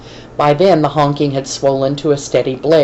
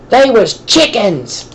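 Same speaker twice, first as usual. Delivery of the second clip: higher, slow bellow; 7 semitones higher, 3 dB louder, slower than usual. A narrator's reading. Bald guy yelling after Roy's bike, bellowing. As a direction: yell it after a fleeing kid. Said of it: "the bald guy bellowed after him".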